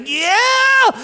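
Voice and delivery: loud, high, long-held cheer; Mark Anderson. Retold or imitated. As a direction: imitate a wildly joyful shout, stretching each word. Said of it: "and he's like".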